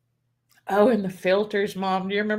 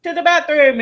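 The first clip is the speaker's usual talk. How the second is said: put-on higher and louder, kid announcing a need; urgent child; 8 semitones higher, 7 dB louder than usual. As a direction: blurt it out like a little kid. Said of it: "then she would be like".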